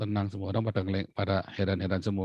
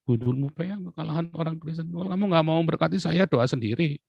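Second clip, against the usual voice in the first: higher, scoffing comeback; mild mock anger; indignant retort; 7 semitones higher than usual. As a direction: brush off the pastor's complaint. Retort to objecting pastor.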